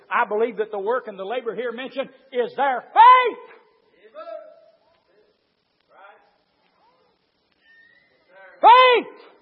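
loud climb to a high shout on Faith; preaching at full force. Acted. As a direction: build and then cry out the word.

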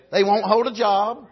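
normal talking voice

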